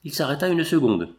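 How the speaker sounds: plain reading voice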